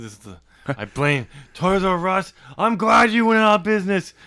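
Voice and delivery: loud, high mock rant; ranting at Toys R Us. Daniels blaming Toys R Us, loud and high rant. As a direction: rant loudly, hammy. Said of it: "He's like".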